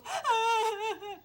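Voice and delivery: loud, high-pitched fake wail; mock crying wail. Child who sees mommy's alarmed face, mock crying wail. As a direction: burst into a child's put-on crying. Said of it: "starts to cry, right?".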